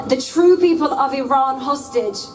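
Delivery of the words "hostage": ordinary talk, nothing marked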